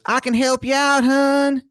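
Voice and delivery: very high-pitched, hammed-up Maryland accent; Wizard of Baltimore, awful Maryland accent. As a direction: squeal it in the worst Maryland accent. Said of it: "this man's accent is something".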